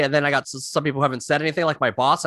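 ordinary talk

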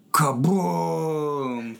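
usual speaking voice

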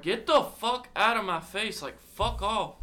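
high-pitched get-out yell; mock yell at friend. Retelling yelling at the friend in his face. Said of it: "And this, I'm like".

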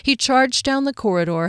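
ordinary delivery

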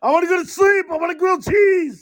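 high, loud whine through laughter; laughing mock whine for snacks; whiny stoned friend. Cohost playing the stoned friends, laughing high whine.

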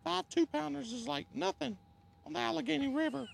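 high strained falsetto voice; cracking high pretend voice. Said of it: "my voice is cracking up".

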